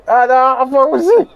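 high, loud, drawn-out yell; slow, hammed-up shouted announcement; playful shout. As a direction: stretch the name into a high holler. Playfully blurts out a name.

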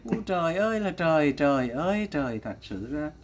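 despairing sigh of disbelief; exasperated lament. Real, not acted. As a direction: lament sinking away to near silence. Lamenting after the clip.

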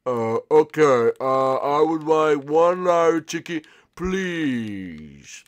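slow, drawn-out fake grown-up; manly voice. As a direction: pose as an adult customer. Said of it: "put on my manly voice".

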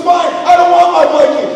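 high, loud tantrum retort; bratty retold outburst; child's defiant shout. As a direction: imitate a child yelling back. Child shouting back, acted.

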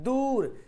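दूर with a long held oo; stretched long oo. Shown as a demonstration. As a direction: drag out the oo in दूर. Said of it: "वाव को खींच रहे हैं". (दूर का ऊ खींचकर बोलें)

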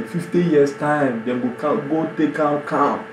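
ordinary delivery

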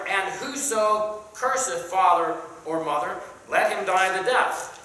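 King James read with preacher's lift; scripture reading. Acted. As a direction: declaim the verse to the class. Reads scripture aloud to class.

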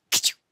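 loud, explosive mock sneeze; acted sneeze. Joe imagining Billy sneezing on pepper, explosive mock sneeze. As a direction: burst out with a fake ka-chow. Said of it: "all of a sudden he'd sneeze".

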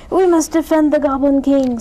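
rally the troops at full voice; rallying cry. A narrator's reading. Other goblins rally round their king, loud high cry.

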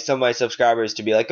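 ordinary talk, nothing marked

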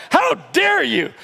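high, drawn-out indignation; loud, slow, high-pitched reproach; mock outrage. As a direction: scold them in hammed-up affront.

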